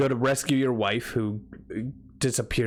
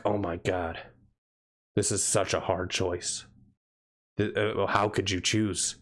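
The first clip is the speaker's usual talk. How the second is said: low, hushed fake gravity; hammed-up dramatic seriousness, kept low; mock solemn; 3 semitones lower than usual. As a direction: agonize over it with halting pauses. The game acted as gravely dramatic.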